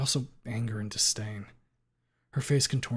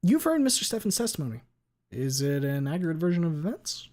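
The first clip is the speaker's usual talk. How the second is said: higher, louder lawyer question; 6 semitones higher, 5 dB louder than usual. Acted. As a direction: ask it like a sharp attorney.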